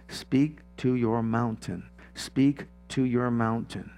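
spaced-out, solemn verse quotation; slow scriptural command. Acted. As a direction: quote it slowly and deliberately, word by word.

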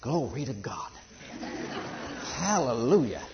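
laughing with delight through the praise; joyful laughing praise. Retold or imitated. Adam praising God at first sight of woman, laughing. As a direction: chuckle it out in happy wonder. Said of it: "That's what he said".

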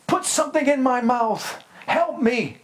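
put-on high, pleading baby cry for milk; mock crying baby. Preacher mimics crying baby.

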